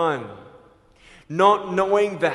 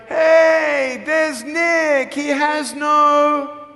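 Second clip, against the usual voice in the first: higher, louder jeering shout; imitating a bully yelling across the hall; bully's taunt; 9 semitones higher, 6 dB louder, much slower than usual. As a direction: call it out to humiliate him in the hallway. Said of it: "he's like".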